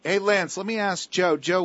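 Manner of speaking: usual speaking voice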